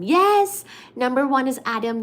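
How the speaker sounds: loud, high cheer then proclaim; excited announcement. Real, not acted. Streamer crowning the top fan, cheering loudly. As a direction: announce the winner with glee.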